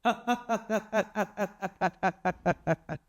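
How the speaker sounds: a spirit's ringing mocking cackle; ghostly mocking laughter; evil cackle. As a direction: laugh to terrify the boy. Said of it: "तो वो बोलती है".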